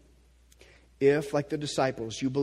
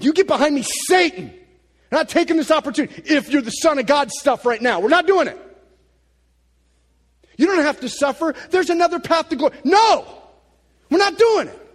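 fierce rebuke; 13 semitones higher, 13 dB louder than usual. Retold or imitated. Jesus voiced rebuking Peter.

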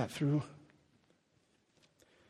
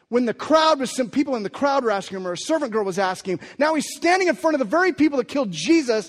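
much higher and louder, driving the story; full-blast preacher telling Peter's stand; preaching at full force; 7 semitones higher, 10 dB louder than usual. Acted.

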